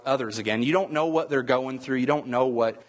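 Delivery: ordinary talk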